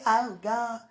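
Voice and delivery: ordinary delivery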